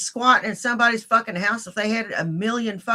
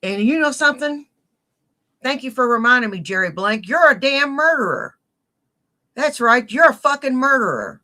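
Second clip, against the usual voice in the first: raised, louder accusing rant; angry rant; 5 semitones higher, 4 dB louder than usual. Real, not acted. Accusing a streamer of murder, angry and loud. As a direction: lay into him, loud and furious. Ranting at a troll accusing him.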